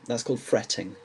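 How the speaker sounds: ordinary delivery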